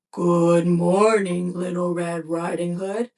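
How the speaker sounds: a low, slow, gruff big bad wolf; lightly voiced wolf greeting her with slow gruff menace. A narrator's reading. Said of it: "a gruff voice said".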